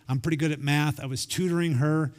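usual speaking voice

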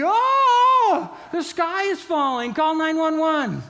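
high, loud panicked cry; hammed-up alarm, like the sky is falling; mock panic. Panicked reaction acted out.

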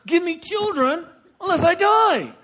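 hammed-up in-your-face demand; high, slow, loud mock fury; Rachel. Rachel grabbing husband by the collar, hammed-up furious demand. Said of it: "She gets in her husband's face".

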